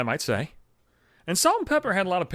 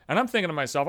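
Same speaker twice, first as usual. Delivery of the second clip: ordinary delivery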